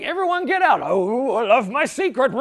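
hammed-up foolish ruler; mock king. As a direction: play a dim, gloating king. King dismisses attendants, gloating.

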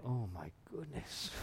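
half-whispered sinking fear; dread. Retold or imitated. Achan hearing his tribe called out, dismayed under his breath. Achan realizes he's caught.

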